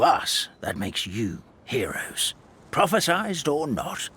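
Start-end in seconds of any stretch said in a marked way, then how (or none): 0.6-2.3 whispered. Acted. Unvoiced whisper heard.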